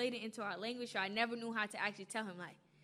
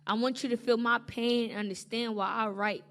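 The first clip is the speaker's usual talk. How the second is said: spoken word performance; 5 dB louder than usual. Acted. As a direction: slam poetry delivery.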